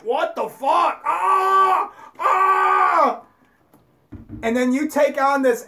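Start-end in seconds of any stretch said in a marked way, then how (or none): 0.0-3.2 mock dying scream, high and loud; dying animal. Animal about to be slaughtered screaming in panic. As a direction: cry out like an animal being slaughtered. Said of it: "right before that animal died".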